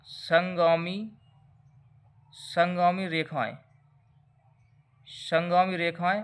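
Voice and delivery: संगामी said slowly and deliberately each time; slow repeated term. Shown as a demonstration. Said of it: "तो पांचवा point क्या हो जाएगा".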